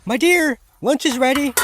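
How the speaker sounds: a wife calling her husband to lunch; high sweet wife's voice; Manette's voice. Acted.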